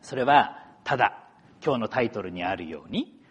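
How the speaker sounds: usual speaking voice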